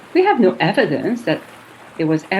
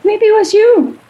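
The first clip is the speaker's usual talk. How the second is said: accusing; 13 semitones higher, 5 dB louder than usual. Acted. Woman accuses lover.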